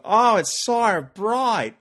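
high, loud, drawn-out Australian accent; Australian accent from a Brit blinded by the sun. Shown as a demonstration. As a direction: wail it slowly in a broad, open-mouthed Australian accent. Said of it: "'Cause what you do with an Australian accent".

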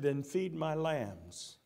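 soft, low and slow, a tender command; gentle Jesus voice. Acted. Jesus tenderly charging Peter to care for his lambs. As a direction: lay the charge on him gently.

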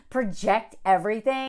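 ordinary talk, nothing marked

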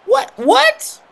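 very high shocked what; disbelief. Real, not acted. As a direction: squeal in disbelief. Disbelief at the call.

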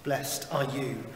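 ordinary talk, nothing marked

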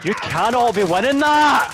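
slow, loud, high disbelief; excited shout. Real, not acted. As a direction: stretch it out in thrilled disbelief. About to win the match.

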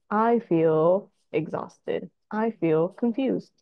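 very slow, deliberate modelling; slow I statements. Shown as a demonstration. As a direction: spell out each feeling slowly for a child. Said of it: "So we use I statements".

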